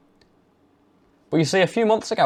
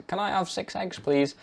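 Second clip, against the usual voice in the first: meek asking; 8 semitones higher, 7 dB quieter than usual. Shown as a demonstration. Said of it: "So if you ask".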